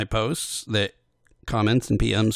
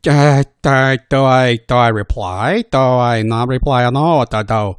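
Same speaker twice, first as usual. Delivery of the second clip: louder, flustered self-questioning; 8 dB louder than usual. Real, not acted. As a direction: dither out loud, flustered. Anxious inner dilemma.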